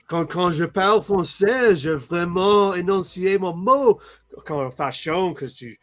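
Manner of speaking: careful Franco-Ontarian French, every word enunciated. Shown as a demonstration. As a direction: speak Franco-Ontarian French, crisp and deliberate. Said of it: "I speak French with, like, this".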